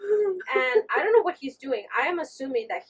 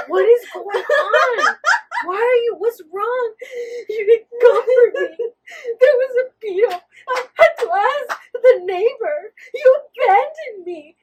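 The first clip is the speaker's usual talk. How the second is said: tearful complaint; 9 semitones higher, 11 dB louder than usual. Retold or imitated. Scolding husband who stayed in the shower, mock tearful wail. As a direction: whine it like you were abandoned.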